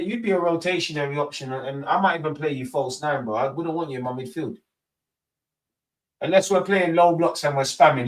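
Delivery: ordinary talk, nothing marked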